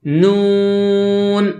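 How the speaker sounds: نون with a clear long oo, not nasal; drawn-out oral نون, kept out of the nose; out of the mouth. Shown as a demonstration. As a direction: sustain نون through the mouth on a level note. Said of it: "منہ سے آواز نکال کر".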